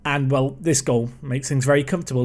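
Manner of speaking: ordinary delivery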